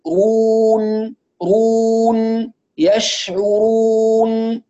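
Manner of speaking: رون very slow, lips rounding then returning at the ن; rounded then relaxed. Shown as a demonstration. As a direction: draw out يشعرون, lips back to rest on the nun. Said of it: "ثم تعود الشفتان لوضع السكون". (ضم ثم عودة الشفتين)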